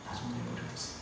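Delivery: hushed plea to be left alone, stress on 좀; near-whispered refusal. Retold or imitated. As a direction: breathe it almost to nothing, leaning on 좀. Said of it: "그 말이에요".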